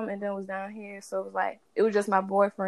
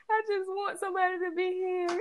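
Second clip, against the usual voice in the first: high, soft tearful wail; imitated crying; 9 semitones higher, 7 dB quieter than usual. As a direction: re-enact a tearful plea, pitched way up and quiet. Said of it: "I just bust out crying".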